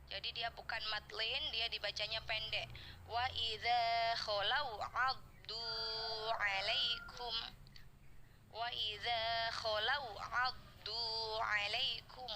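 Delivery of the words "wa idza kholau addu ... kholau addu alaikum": slow, drawn-out tajwid recitation; Quran recitation. Shown as a demonstration. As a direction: chant the verse slowly, every vowel stretched. Recites the verse for students. (bacaan tartil yang pelan)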